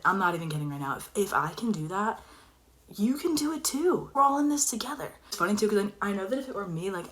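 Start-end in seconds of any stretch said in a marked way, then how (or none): none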